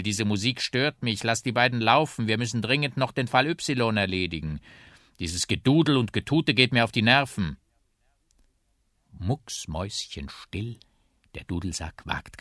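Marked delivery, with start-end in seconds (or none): 0.0-7.6 loud, raised complaint; irritated calling. A narrator's reading. Policeman trying to phone complains about the tootling, irritated call. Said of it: "ruft ein Polizist".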